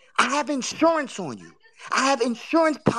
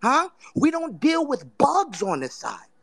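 normal talking voice

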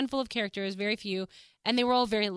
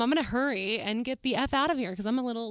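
usual speaking voice

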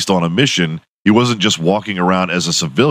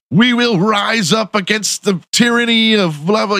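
high, hammed-up heroic leader; mock rallying speech; 13 semitones higher than usual. Jason rallying the villagers, hammed-up high speech. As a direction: rouse the villagers, tongue in cheek. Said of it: "he's like".

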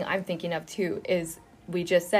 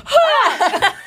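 high, loud mock shriek; comic scream of fright; startled scream; 9 dB louder than usual. Frightened disciples as Jesus appears in the room, mock startled scream.

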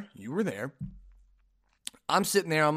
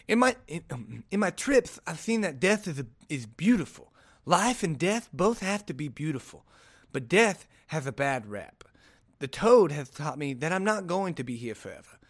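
lisping boxer impression, pitch wandering; amateur lispy take on a famous boxer; Mike Tyson. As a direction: read the quote with a thick put-on lisp. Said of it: "my Mike Tyson impression".

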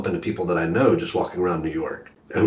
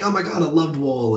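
higher-pitched imitation of a fan freaking out; retold freaking out; 7 semitones higher than usual. Writer retelling a stranger recognizing him, mock freaking out. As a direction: mimic a starstruck fan's high gush. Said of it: "she's, like, freaking out".

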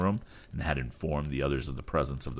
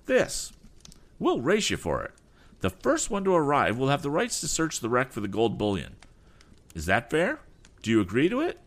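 high, sneering rival voice; insolent reply; 10 semitones higher than usual. Acted. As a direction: taunt him with insolence. Rival proposes race.

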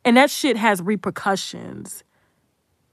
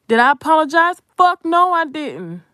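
louder and higher, spitting out the refusal; defiant outburst; 6 semitones higher, 4 dB louder than usual. Real, not acted. Vlogger to viewers about apologizing, defiant loud outburst. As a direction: slam it down like a flat refusal.

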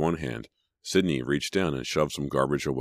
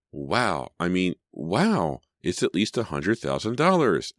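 plain reading voice

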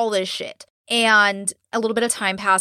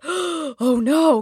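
sharp gasp, then higher-pitched "oh no"; mock dismayed gasp; 6 semitones higher than usual. Recalling her reaction to reading it, gasping dismay. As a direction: re-enact a gasp of dread.

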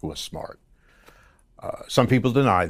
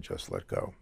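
whispered low and soft, like a calm voice in a dream; the man in the dream telling him to let go, in a whisper; hushed whisper; 11 semitones lower, 12 dB quieter than usual. Retold or imitated. Breathy whisper of a mysterious stranger.

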